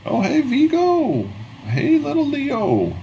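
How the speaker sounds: high falsetto vendor voice, drawn out; very high, slow friendly street seller; vendor's greeting. Acted. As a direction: sing out a slow, high-pitched hello to regulars. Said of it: "the vendor".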